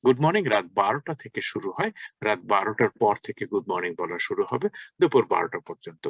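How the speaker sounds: normal talking voice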